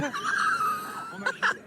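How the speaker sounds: high, slow question through a laugh. Real, not acted. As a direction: burst out laughing as you ask. Laughing disbelief at weight claim.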